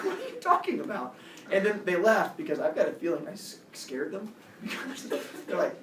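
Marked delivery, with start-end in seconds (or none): 0.0-1.1 very high-pitched disbelief; incredulous retort. Retold or imitated. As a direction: squeal it in mock disbelief.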